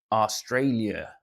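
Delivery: slow, botched Aussie try at Australia; bad Australian accent. Streamer tries an Aussie accent for Australian viewer, clumsy. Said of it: "that's not good accent".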